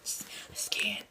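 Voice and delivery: barely voiced giving up; helpless whisper. Real, not acted. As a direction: whisper it, lost for words. Gives up, overcome by disbelief.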